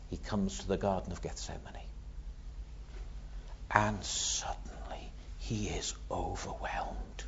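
storyteller's hushed whisper. A narrator's reading. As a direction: drop to a breathless whisper for the garden. Hushed retelling of Gethsemane.

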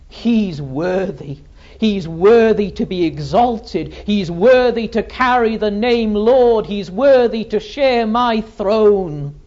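building, loud declaration from the pulpit; ringing sermon crescendo; exultant proclamation. Acted. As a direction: proclaim it, growing stronger each time. Preaches Father's exaltation.

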